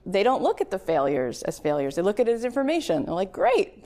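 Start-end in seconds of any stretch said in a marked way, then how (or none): 3.3-3.8 upbeat great. Retold or imitated. Scientist shrugging off failure.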